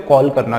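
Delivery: "कॉल" with rounded aw; correct rounded vowel. Shown as a demonstration. Teacher confirming what students should say, rounded aw vowel. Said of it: "क्या बोलते हो".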